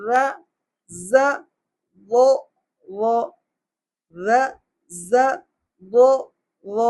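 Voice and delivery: short separate syllables, lisped "ر", buzzed "ز", rounded "و"; distinct letter sounds. Shown as a demonstration. Teacher showing the fourth pair, short careful "ر", "ز", "و". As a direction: isolate "ر", "ز", "و" with pauses. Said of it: "اس کی آواز نکلے گی".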